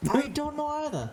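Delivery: hollow, tinny voice like in a can; talking into a tin can. Real, not acted. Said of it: "You sound like you're talking in a can".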